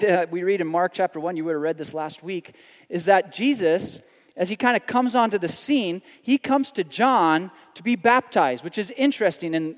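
ordinary talk, nothing marked

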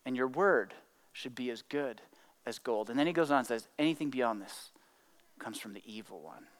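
low and soft, with pauses between phrases; quiet, weighty scripture delivery; hushed solemnity. Acted. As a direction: quote it gravely, almost under the breath. Solemn repeat of quoted saying.

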